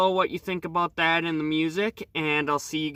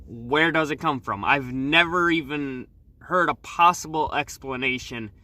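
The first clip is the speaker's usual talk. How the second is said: ordinary talk, nothing marked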